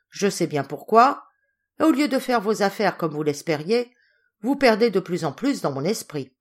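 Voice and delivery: sévère reproche, plus fort; colère. Acted. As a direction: gronde la nièce avec une indignation sévère. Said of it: "signes croissants de colère". (colère froide du général, plus forte)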